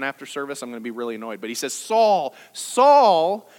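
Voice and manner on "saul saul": "Saul, Saul" called loudly, the second drawn out and louder; emphatic repeated name. Retold or imitated. Jesus calls out to get Saul's attention, "Saul, Saul" in loud emphasis. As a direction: hit "Saul, Saul" hard, swelling on the repeat. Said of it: "for emphasis".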